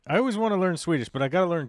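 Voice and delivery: high, loud, rushed; emphatic outburst. Real, not acted. Streamer protesting about wanting to learn Swedish, emphatic rush. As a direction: blurt it out fast and high.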